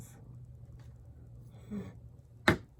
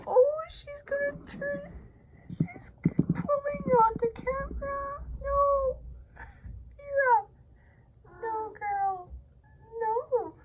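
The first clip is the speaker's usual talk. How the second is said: much higher, loud pleading with a cat; high excited scolding; 8 dB louder than usual. Real, not acted. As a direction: shriek no at a naughty cat. Scolding cat grabbing camera.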